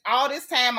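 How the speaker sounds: normal talking voice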